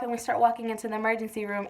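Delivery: ordinary talk, nothing marked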